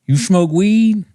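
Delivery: slow, slurred question, rising high; stoned comic impression. Comic picking on an audience member, slow high stoner drawl. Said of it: "he looks at me and he goes".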